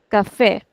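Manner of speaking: café with FÉ stretched; stress on last syllable. Shown as a demonstration. Teacher models café for students, stretching the last syllable. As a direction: lean on fé at the end of café. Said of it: "putting stretch on the last syllable".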